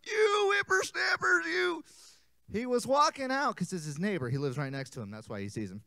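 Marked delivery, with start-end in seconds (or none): 0.1-1.8 put-on high, slow creaky old man; old grandpa voice. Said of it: "Old Man Jenkins".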